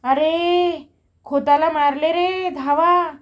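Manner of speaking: urgent shout for others to come running; old woman's alarm cry. A narrator's reading. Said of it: "एका म्हातारीने ओरड केली".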